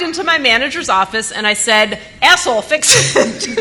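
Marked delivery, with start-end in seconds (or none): 2.2-3.6 high, loud, slow laughing command; amused mock demand to the manager; laughing mock order. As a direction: bark it out while cracking up. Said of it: "Those were my exact words".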